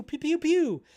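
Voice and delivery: high playful pew-pew blasts; mock gun noises. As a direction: fire off cartoon laser sounds.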